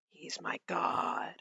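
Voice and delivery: slow, hushed reverent whisper. Acted. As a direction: breathe it like devotion. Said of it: "worshipped him, like".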